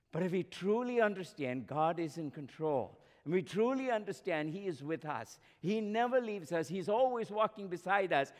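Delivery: normal talking voice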